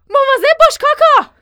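high, loud cry of alarm; shouted warning. A narrator's reading. Father warns Kaka on the roof, shouting. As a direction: yell a warning across the yard. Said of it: "فریاد زد".

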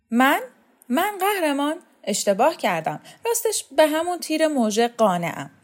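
high-pitched startled protest; alarmed backing down. Acted. Pale Kaveh retracts his offer to Shokat, alarmed. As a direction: squeak in alarm, then hastily take it back. Kaveh pales and hastily backs down.

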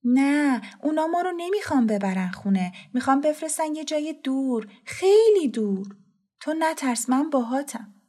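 high, anxious reassurance; trembling voice. Acted. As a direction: put on a brave face in a high, worried voice. (با صدای زیر و لرزان)

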